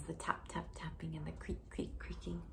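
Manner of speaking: soft whisper, no voice; spooky whisper. Acted. Teacher reading to a child, whispering the scary noises. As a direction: hush the sounds as if he hears them. Retelling scary sounds hushed.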